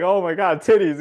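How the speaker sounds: high, loud, awestruck gasp; a sheltered monk seeing it all for the first time; delighted shock at the city streets; amazed monk. Retold or imitated. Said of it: "And he's like".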